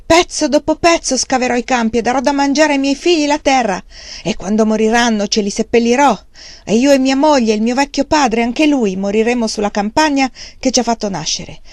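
loud, high, raging defiance; furious. Acted. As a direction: rage at them with tears close. Wang Lung raging at the buyers. (rabbia furiosa, a voce alta)

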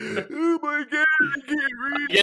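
hammed-up gasping for air; mock choking panic. Host acting out choking on pancakes, mock choking panic.

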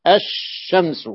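الشمس drawn out slowly, doubled shin and no lam; الشمس slowly, L dropped into the sh; slow recited example. Shown as a demonstration. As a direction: say الشمس as "ash-shams", stretched. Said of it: "التي لا تنطق".

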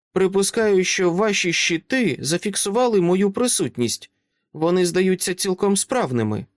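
put-on high child voice, calm and grown-up; a small girl who talks like an adult; composed little girl. Child asks Dresden to let them in, composed and high.

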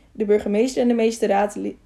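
ordinary delivery, nothing marked

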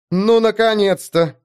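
high, slow grumbled relief; grumbling. Acted. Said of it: "невольно буркнула Эста".

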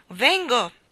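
loud high reply; calling out. A narrator's reading. Mary Poppins calling back to the ticket man. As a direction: shout back while hurrying.

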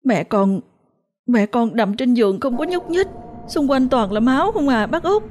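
put-on a terrified child blurting it out, pitch climbing; breathless frightened child voice; trembling with fear. A narrator's reading. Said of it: "nó mới trung rẩy nói".

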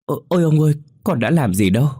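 high, loud stammering cry; student crying out at the ghost's face, high and loud; frightened wail. A narrator's reading. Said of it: "miệng méo sạch kêu lên".

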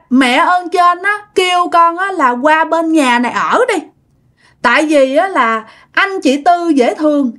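put-on high, sing-song little-child voice; singsong toddler voice. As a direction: chant it sweetly like a small child. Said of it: "giống như đứa con nít mà hai ba tuổi".

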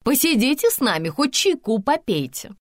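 high, insistent plea to stay; indignant coaxing. A narrator's reading. Ira urging the older woman to stay, insistent. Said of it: "возмутилась Ира".

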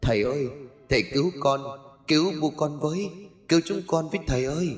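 put-on high, soft ghost-child plea; eerie whispering child. A narrator's reading. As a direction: beg for rescue in a small, hushed voice. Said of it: "cất giọng thì thào đầy ma quái". (giọng trẻ con thì thào ma quái)

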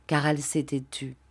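ordinary delivery

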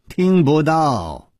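slow, fading wryly; wry sigh. A narrator's reading. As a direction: trail off with a rueful smile. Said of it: "楚留香苦笑着说". (苦笑着慢慢说)

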